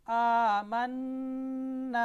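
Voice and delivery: "आमन्ना" slow and loud, noon and alif kept thin. Shown as a demonstration. Teacher repeats the correct reading, "आमन्ना" with thin noon. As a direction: hold the hum in "आमन्ना", then a long light "ना". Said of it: "नून को बारीक पढ़ना है".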